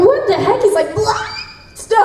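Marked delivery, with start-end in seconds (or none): 0.1-0.6 high, baffled exclamation; startled. Retold or imitated. Retelling her reaction to the Japanese take, high and startled. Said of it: "I'm like". 0.9-2.0 very high shouted call; high-register call. Retold or imitated. Imitating the Japanese actor's very high shout. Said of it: "hitting these registers I've never heard a man hit before".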